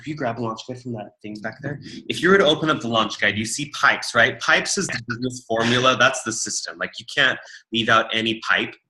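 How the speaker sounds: ordinary delivery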